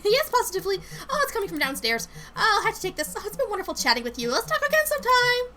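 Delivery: high, rushed, overly cheery voice; evasive Lindsay. Acted. Coworker making excuses to take a call, high and falsely cheery. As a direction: giggle and hurry off in a high voice.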